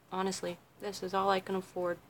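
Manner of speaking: softly admitting it to the viewers; quiet aside. Shown as a demonstration. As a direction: drop it down like an honest confession. Repeats line, then quieter confiding take.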